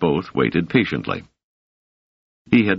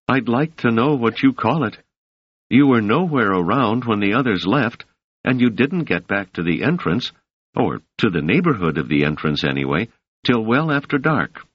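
lightly raised voice for Doris, calm; a hint of the young woman, measured and soothing; 7 semitones higher than usual. Doris explains the men's mistake to Selden: lightly raised, calm.

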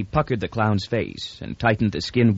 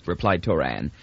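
ordinary delivery

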